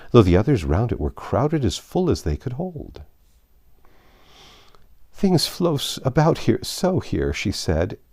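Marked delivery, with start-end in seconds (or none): 5.1-7.3 high plaintive complaint. Acted. Alice chasing shelf things, plaintive. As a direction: complain a little helplessly. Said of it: "in a plaintive tone".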